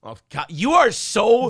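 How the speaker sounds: slow, drawn-out teasing groan; exasperated teasing. Real, not acted. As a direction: draw it out, playfully exasperated. Teasing co-host.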